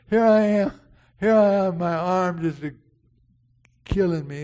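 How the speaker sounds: imitating himself calling out in pain, loud; a hammed-up hurt patient calling out; mock plea for help. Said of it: "you go".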